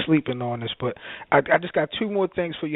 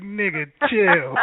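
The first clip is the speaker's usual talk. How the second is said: slow, higher, drawn-out "chill" to a caller; mock brush-off; 5 semitones higher, 3 dB louder, slower than usual. Host retelling how he brushed off callers, slow mock brush-off. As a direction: wave it off, amused and unbothered. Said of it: "I'm like".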